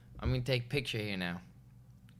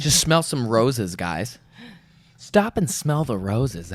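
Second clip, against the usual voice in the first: louder and slow, mock-preachy; grand declaration; 9 dB louder, slower than usual. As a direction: proclaim it like a guru.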